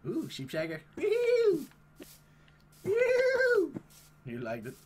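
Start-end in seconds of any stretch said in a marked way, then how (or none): 1.0-1.6 very high loud falsetto whoop; whooping cheer. Real, not acted. Player riding in VR, high loud whoop. 2.8-3.7 very high loud falsetto whoop; whooping cheer. Real, not acted. Player riding in VR, high loud whoop.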